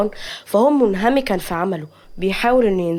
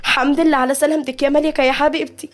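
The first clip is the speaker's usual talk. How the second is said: high-pitched happy welcome; glad, raised-pitch relief; joyful; 6 semitones higher than usual. A narrator's reading. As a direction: greet a loved one with delight, voice lifted high. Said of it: "نادية بفرحة".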